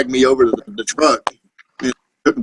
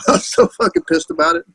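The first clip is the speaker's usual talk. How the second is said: laughing; 13 semitones higher, 3 dB quieter than usual. Real, not acted. Laughing while telling.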